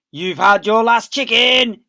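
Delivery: slow, loud snarling yell; yelling Boggis. Acted. Boggis yelling at the fox. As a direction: spit it out as a threat. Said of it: "yelled Boggis".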